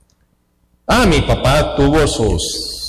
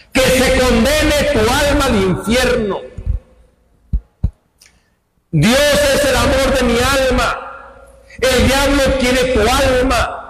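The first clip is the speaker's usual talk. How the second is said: high, impassioned preaching about the soul; fervent sermon warning; 9 semitones higher than usual. Acted. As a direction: raise the pitch and warn them of the devil.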